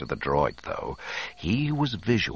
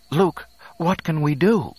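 high, louder anxious appeal; urging; 12 semitones higher, 6 dB louder than usual. A narrator's reading. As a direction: urge him to act. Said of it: "Leia urged".

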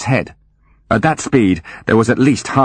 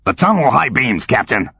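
gruff commander; 3 semitones higher than usual. Acted. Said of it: "A different voice, not so cuddly".

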